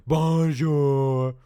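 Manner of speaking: high, loud drawn-out monster greeting; Muzzy. Shown as a demonstration. Trying out a monster voice for this guy, loud and drawn out. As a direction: stretch it long like a cartoon monster. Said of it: "give this guy Muzzy voice".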